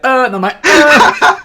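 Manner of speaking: very high, loud laughing wail; laughing mock wail. Fan recapping the finale, a loud high laughing wail.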